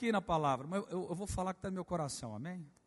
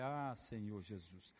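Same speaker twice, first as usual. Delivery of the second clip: soft sighing prayer; 8 semitones lower, 10 dB quieter, slower than usual. Retold or imitated. Pastor's sighing prayer in story.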